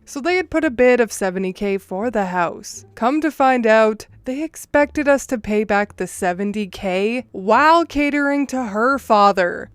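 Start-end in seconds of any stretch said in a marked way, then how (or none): none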